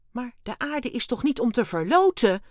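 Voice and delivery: high, loud protest; exclaiming. A narrator's reading. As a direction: cry out in disbelief. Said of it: "riep Dexter uit". (luid verontwaardigd uitroepen)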